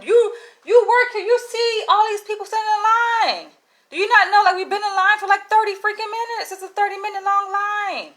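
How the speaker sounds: frustrated high-pitched complaint; exasperated rant. Real, not acted. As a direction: vent it at the clerk, voice up high. Ranting at clerk, high.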